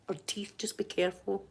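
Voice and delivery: very soft, discreet repeat; hushed warning. Acted. Repeating the warning to a puzzled partner, hushed. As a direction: drop right down for a private caution.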